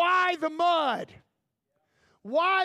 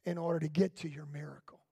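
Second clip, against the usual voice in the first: quick unvoiced whisper; 10 semitones lower, 6 dB quieter, faster than usual. Real, not acted. Preacher telling Naaman what it takes to get healed, in a whisper. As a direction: drop to a whisper to land the point. Preacher drops to close the point.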